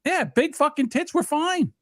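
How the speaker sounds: high, drawn-out indignation; stretched-out, high-pitched complaint; indignant protest. Real, not acted. Protests the inconsistent Twitch ban.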